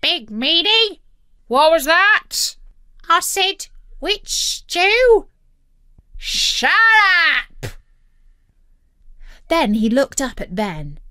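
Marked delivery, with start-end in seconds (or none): 0.0-5.3 high squawky parrot voice; high, loud parrot chatter; cheeky parrot. A narrator's reading. Parrot talks back to captain. 6.2-7.7 angry pirate. A narrator's reading. Captain silences the parrot.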